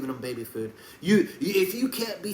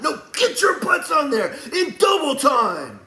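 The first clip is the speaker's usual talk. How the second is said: high, loud, drawn-out bark at the troops; big commanding shout, words stretched out; drill-sergeant yell; 11 semitones higher, 7 dB louder, slower than usual. Acted. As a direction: yell orders like a hard coach. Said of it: "we don't yell at them the way I'm yelling at you".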